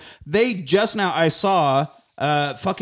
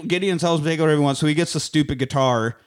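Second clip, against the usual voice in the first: usual speaking voice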